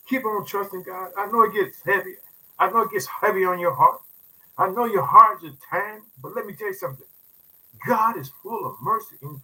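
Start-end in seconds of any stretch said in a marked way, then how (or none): none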